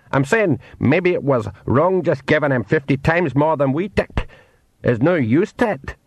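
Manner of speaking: small Scottish pixie voice. Acted. Pixie man speaks.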